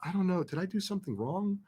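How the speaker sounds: hushed nervous second-guessing; nervous inner worry. Retold or imitated. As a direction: murmur the worry to yourself. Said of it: "literally starting to sweat".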